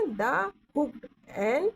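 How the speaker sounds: drawn-out reading of the term; slow reading aloud. Shown as a demonstration. Teacher slowly reads the term to her students. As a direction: stretch each word for the class. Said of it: "hook, N का hook लगेगा".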